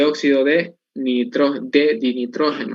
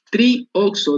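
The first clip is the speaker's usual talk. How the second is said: slow spelled-out term; 6 semitones higher than usual. Shown as a demonstration. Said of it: "¿de dónde viene el tri?".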